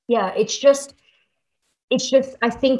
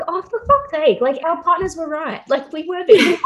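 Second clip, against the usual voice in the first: higher, louder, half-laughing oh-for-fuck's-sake; mock exasperated realisation; 8 semitones higher, 4 dB louder than usual. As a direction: pretend future self admitting the partners were right. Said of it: "you'll be able to be like".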